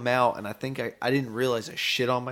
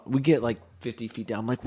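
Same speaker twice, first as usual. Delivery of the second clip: usual speaking voice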